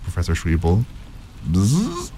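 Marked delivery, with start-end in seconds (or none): 1.5-2.1 high buzz rising at the end; bee buzz. A narrator's reading. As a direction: imitate a bee, encouragingly. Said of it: "Imitate a bee".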